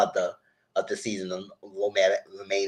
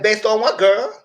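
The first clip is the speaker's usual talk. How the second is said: high, louder rising challenge; challenging; 11 semitones higher, 6 dB louder, slower than usual. Retold or imitated. To the castmate, a high rising challenge. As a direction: demand a reason.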